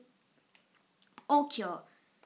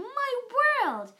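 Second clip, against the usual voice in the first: shouted; 9 semitones higher than usual. A narrator's reading. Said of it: "they all shouted".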